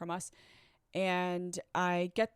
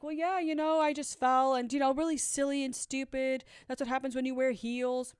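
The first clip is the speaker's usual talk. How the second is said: bright, higher, overly casual lie; nervous cover story to the doctor, pitched up and breezy; fake breezy excuse; 5 semitones higher, 3 dB louder than usual. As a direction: brush it off like it's nothing silly. Lying to the hand specialist.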